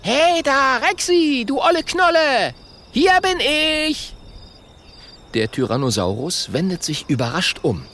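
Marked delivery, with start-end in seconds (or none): 0.0-4.1 high, loud taunting call. Acted. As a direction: tease the dinosaur from afar.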